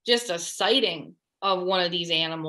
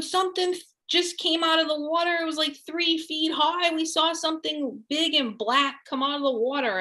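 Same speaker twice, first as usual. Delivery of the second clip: much higher, mock panicked cry about the water; retold alarmed shouting; 8 semitones higher than usual. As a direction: act out the friends' startled alarm, pitched up high. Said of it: "they start screaming".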